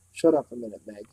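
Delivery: plain reading voice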